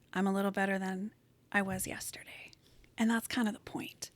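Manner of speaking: hushed self-encouragement; soft whisper. Real, not acted. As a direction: whisper it like a private admission. Quiet admission of progress.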